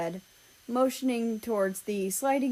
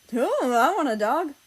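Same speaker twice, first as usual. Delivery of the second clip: eager want; 6 semitones higher, 7 dB louder than usual. Acted. Eager reply in group chat.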